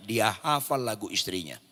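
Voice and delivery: ordinary talk, nothing marked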